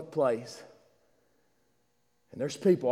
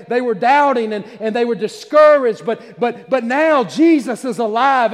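high, loud, driving sermon delivery; impassioned pulpit crescendo; fervent preaching; 8 semitones higher, 10 dB louder than usual. Real, not acted. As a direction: preach it at full force, phrase piled on phrase. Preaches at full force.

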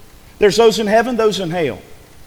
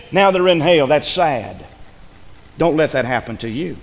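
ordinary talk

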